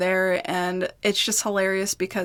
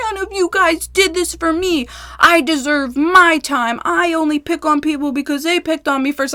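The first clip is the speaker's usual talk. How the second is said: higher, whiny mock rant; mocking John; 7 semitones higher than usual. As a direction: parody a self-pitying man. Mocking John's social media rant.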